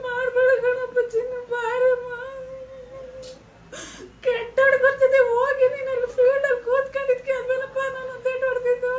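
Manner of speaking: high, crying plea to come home; begging through tears, voice breaking; tearful pleading. Real, not acted. Mother calls her missing son home, crying.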